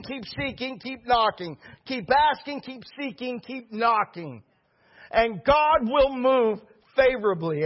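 high, punched, rhythmic exhortation; impassioned preaching. Real, not acted. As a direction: drive each phrase home to the congregation. Preaches an insistent refrain.

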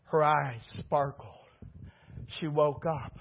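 ordinary delivery

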